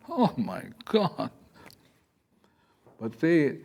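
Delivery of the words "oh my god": dramatic Hamlet exclamation. Retold or imitated. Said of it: "like the soliloquy in, in Hamlet".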